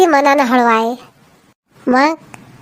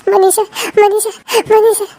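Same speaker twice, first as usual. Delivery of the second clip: wailing; 7 semitones higher, slower than usual. Acted. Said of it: "रडू नका म्हणून".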